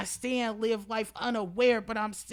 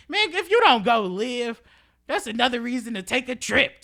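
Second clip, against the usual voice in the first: louder, higher call to go live; loud exhortation; 5 semitones higher, 8 dB louder than usual. Real, not acted. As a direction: push them to travel, loudly. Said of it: "loud exhortation".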